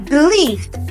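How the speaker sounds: ordinary delivery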